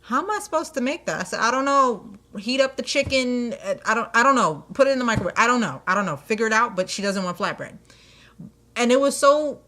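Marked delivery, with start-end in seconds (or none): 0.0-1.2 confused sandwich maker. Retold or imitated. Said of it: "he looks at me and goes". 1.4-7.8 exasperated. Retold or imitated. Exasperated reply to the sandwich maker.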